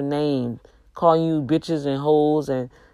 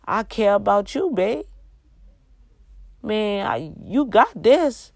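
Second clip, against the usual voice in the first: sweet encouraging wife; 6 semitones higher than usual. Pretend. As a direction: build your man up tenderly. Voicing what wife should say.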